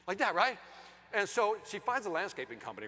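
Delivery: normal talking voice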